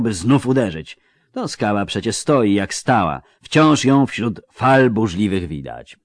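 grand, archaic battle telling; chronicle narration. A narrator's reading. Narrator recounting the battle, solemn and sweeping. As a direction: declaim like an old chronicler.

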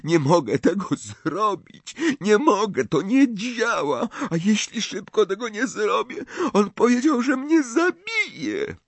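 put-on high, tearful, desperate boy; weeping Malfoy confessing his dread; crying. A narrator's reading. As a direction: sob it out in rising panic. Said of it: "że Malfoy płacze".

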